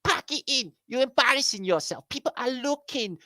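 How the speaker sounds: high fussy scold; prim high voice. Acted. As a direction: scold in a high prissy pitch.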